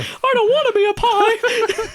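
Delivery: very high, laughing cartoon mouse voice; laughing falsetto Mickey voice; Mickey Mouse squeak. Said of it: "Why am I Mickey Mouse?".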